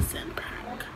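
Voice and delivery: breathy nervous whisper. Real, not acted. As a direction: whisper it so nobody hears. Whispering while pressing send.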